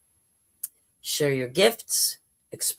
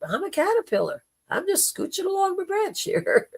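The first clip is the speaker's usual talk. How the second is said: high squeaky caterpillar voice; squeaky little critter scoffing; 13 semitones higher than usual. Retold or imitated. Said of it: "they would look at you like".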